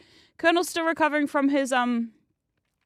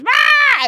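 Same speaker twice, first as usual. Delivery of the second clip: loud, high-pitched raw screech; sudden piercing "rah"; imitated cat yell; 11 dB louder than usual. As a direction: yell like a demanding cat in someone's face. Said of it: "he does yell at you".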